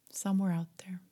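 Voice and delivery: ordinary delivery, nothing marked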